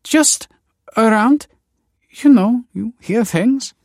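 high, halting, evasive answer. A narrator's reading. Nina challenged on where she heard it, evasive.

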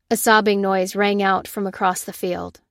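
usual speaking voice

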